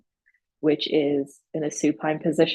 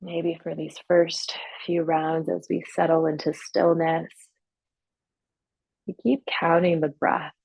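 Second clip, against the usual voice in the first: guided meditation. Real, not acted. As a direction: ease them gradually into stillness, leaving long silences. Leads guided breathing and relaxation.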